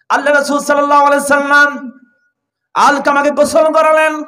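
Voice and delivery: normal talking voice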